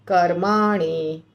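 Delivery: कर्माणि slow, weight on Karma and light on णि; stress on karma. Shown as a demonstration. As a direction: lean on Karma, soften the णि. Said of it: "Karma के ऊपर ज़्यादा जोर है". (कर्मा पर ज़ोर, णि पर कम)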